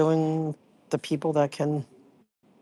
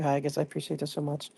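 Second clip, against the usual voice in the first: ordinary delivery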